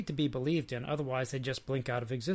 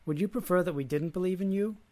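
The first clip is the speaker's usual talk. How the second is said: mildly irritated, higher-pitched question; testy challenge, lightly acted; snapping; 6 semitones higher, 3 dB louder than usual. A narrator's reading. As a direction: put a touch of snap into the question. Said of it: "snapped Elijah".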